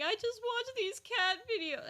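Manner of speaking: high, slow tearful whine; mock crying. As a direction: wail it high, drawn out and hammed-up. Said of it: "crying to Nightmare saying".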